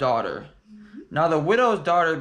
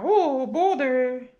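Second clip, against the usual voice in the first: higher, slow woo; mock cheer; 11 semitones higher, much slower than usual. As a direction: cheer it out, stretched. Cheering memory of climbing.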